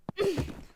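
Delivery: loud, high burst of scornful laughter. Acted. Fiancée laughs scornfully at her partner's hatred. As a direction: laugh right in their face.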